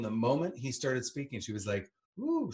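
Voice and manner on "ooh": high-pitched mock-smitten "ooh"; imitating a titillated coo. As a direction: swoop up high as if thrilled. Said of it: "she was completely titillated".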